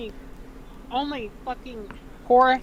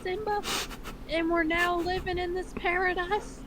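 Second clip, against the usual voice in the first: lightly voiced fond mother, higher; hammed-up cartoon mom reassuring her son; mother's voice; 7 semitones higher than usual. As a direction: read Ma a pitch up with a motherly lilt. Reads Ma's dialogue.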